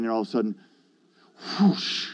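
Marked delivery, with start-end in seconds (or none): 1.6-2.1 breathy, voiceless rush of air; imitated whoosh. Man retelling the moment at the altar, imitated whoosh. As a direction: make a soft whooshing noise.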